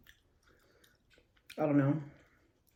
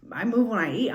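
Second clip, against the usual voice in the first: ordinary delivery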